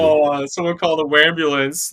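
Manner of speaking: high-pitched teasing fake concern; mock crybaby. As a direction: taunt him in a high put-on voice. Mocks friend as a crybaby.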